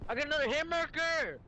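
high-pitched exasperated outburst; frustrated yell. Real, not acted. Gamer complaining to teammates about another hit marker, frustrated yell.

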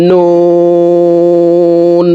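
"নুন" with round lips and a very long oo on a high level tone; four-alif stretch. Shown as a demonstration. Teacher demonstrating noon, long round-lipped hold. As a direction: draw out "নুন" as one steady chanted note. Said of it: "নুনকেও চার আলিফ টেনে পড়তে হবে".

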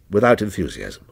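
ordinary delivery

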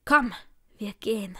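restrained, hissed command; angry hiss. A narrator's reading. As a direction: hiss it at her without raising your voice. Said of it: "fauchte er mir entgegen". (verhalten gefaucht)

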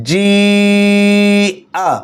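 "জি" held long and level, then short "আ"; four-alif stretch. Shown as a demonstration. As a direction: sustain "জি" steadily before a brief "আ". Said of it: "এটাও মাদ্দে মুত্তাসিল চার আলিফ টানতে হবে".

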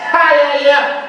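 high, punchy yeah yeah; hammed-up catchphrase; Sérgio Malandro cheer. Shown as a demonstration. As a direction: cheer it like a kids' TV host. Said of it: "Esse é o Sérgio Malandro".

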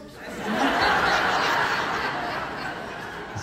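a short airy laugh at her own line; breathy laugh. Real, not acted. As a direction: laugh it off, amused at herself.

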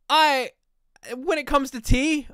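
usual speaking voice

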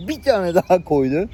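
ordinary talk, nothing marked

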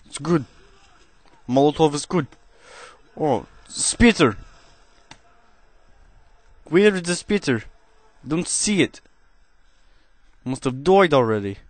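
cheesy put-on Russian accent held through gameplay chat; deliberately hammy Russian accent, never dropping it. Gamer commentating to viewers in a cheesy Russian accent. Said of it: "trying to perform a Russian accent".

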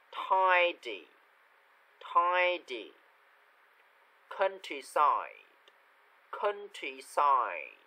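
isolated words, carefully articulated with long gaps; pronunciation model reading. Shown as a demonstration. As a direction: read each word out clearly for learners to copy. Models vocabulary for repetition. (đọc mẫu từng từ rõ ràng)

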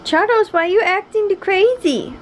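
mock scolding the dog; pet talk. Real, not acted.

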